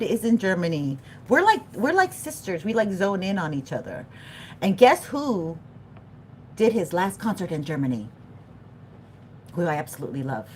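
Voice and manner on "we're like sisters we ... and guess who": normal talking voice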